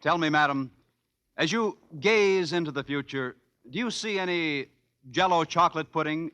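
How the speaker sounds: ordinary talk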